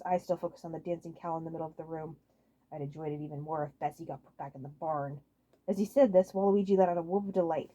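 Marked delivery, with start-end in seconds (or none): 2.7-5.2 low quiet grumble; grumbling. Acted. Wario grumbling about Waluigi, low quiet grumble. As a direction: mutter it sourly. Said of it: "Wario grumbled".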